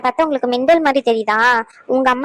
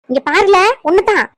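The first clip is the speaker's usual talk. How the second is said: stern warning; 5 semitones higher, 5 dB louder than usual. Acted. Mother warns her son.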